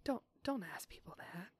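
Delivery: hushed whisper. Real, not acted. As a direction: whisper it to the side. Scolding aside.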